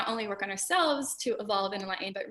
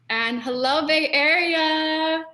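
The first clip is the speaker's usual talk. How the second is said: loud, drawn-out greeting, pitched up; excited shout-out; 7 semitones higher, 8 dB louder, much slower than usual. Real, not acted. Host greets webinar attendees from the Bay Area, excited shout-out. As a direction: call it out long and loud to the crowd.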